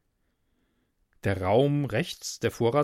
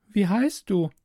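put-on higher, girlish voice; girl's voice; 8 semitones higher than usual. Girl asks stranger through the wall, girlish voice. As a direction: ask as a curious young girl.

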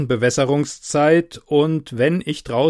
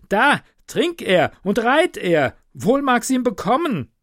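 urging innkeeper; 10 semitones higher than usual. Acted. Innkeeper begs the soldier to drink and ride off, high urgent plea. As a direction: beg him to drink and ride.

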